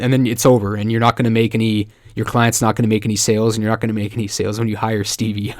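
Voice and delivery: ordinary talk, nothing marked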